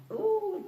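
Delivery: high, drawn-out ooh; delighted ooh. Shown as a demonstration. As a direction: coo high with pleasure. Savouring the move she describes.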